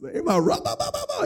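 high gibberish bursts mimicking tongues; imitated tongues babble. Preacher imitating the prayer circle in tongues, high babble. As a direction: rattle off mock tongues, way up high.

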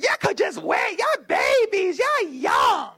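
high, mock nagging relatives. Relatives telling the young couple to wait, high mocking voice. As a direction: ham up the busybody relatives. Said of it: "Everybody's like".

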